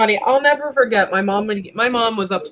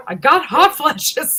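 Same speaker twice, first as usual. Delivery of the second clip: higher, drawn-out comic reply; mock exclamation; 7 semitones higher, 3 dB louder, slower than usual. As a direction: stretch it out as a comic punchline. Acted reply of hot mom.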